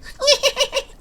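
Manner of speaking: high goofy giggling laugh; squeaky silly cackle; Gary laugh. Acted. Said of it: "That's a Gary laugh".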